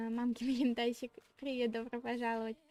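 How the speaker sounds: usual speaking voice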